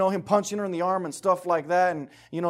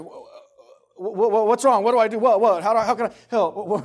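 higher, louder, stammering panic with a laugh; flustered guy; 4 semitones higher, 7 dB louder than usual. Retold or imitated. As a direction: play a man thrown by tears. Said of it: "guys are like".

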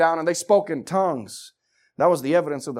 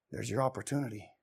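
ordinary delivery

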